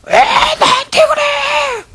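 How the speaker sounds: very high, slow, drawn-out crying whine; bratty wailing complaint; whiny child. Retold or imitated. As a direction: sulk like a kid shouting back at mom. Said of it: "너무 리얼한가요?".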